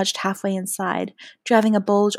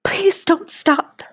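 slow, high plea; high-pitched drawn-out entreaty; pleading murmur; 10 semitones higher, 3 dB louder, much slower than usual. Acted. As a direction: draw it out high, begging. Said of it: "I murmured".

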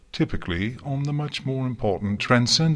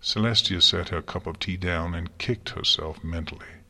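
usual speaking voice

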